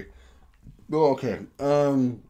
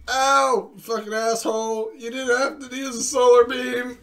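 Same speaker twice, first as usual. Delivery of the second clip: mock pained Pikachu; 14 semitones higher, 6 dB louder, slower than usual. Acting out a Pokemon hit.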